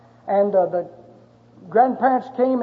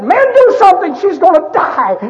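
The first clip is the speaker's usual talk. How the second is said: pleading cry, pitched much higher and louder; desperate shout; 9 semitones higher, 7 dB louder than usual. Retold or imitated. Father begging the doctor to save his baby, desperate shout. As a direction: beg the doctor, near breaking.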